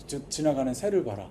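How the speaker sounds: ordinary talk, nothing marked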